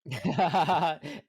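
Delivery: high, loud laugh. Real, not acted. Punster delighted at ban threat for pun, laughing. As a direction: burst into a big, high laugh.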